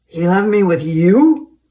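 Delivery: very loud disbelieving bellow; appalled. Acted. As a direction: roar it in disbelief. Frank appalled at Basil.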